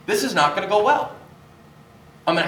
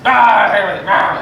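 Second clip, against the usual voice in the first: mock angry grumble; 4 semitones higher, 7 dB louder, slower than usual. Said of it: "and walks out all angry".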